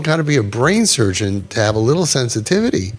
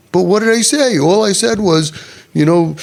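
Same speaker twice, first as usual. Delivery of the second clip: indignant parent; 4 semitones higher than usual. Retold or imitated. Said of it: "People will say that".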